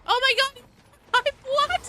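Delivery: high, loud, laughing disbelief; excited shriek. Real, not acted. Streamer shrieks at a surprise party popper, real excitement. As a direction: blurt out astonished delight.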